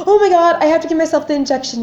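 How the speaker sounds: high, hurried retold panic; acting out a fretful rush of dread; panicking. Patient calling partner about giving herself an injection, panicking. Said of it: "panicking, being like".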